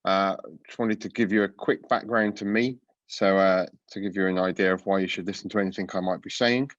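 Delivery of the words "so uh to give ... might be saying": ordinary talk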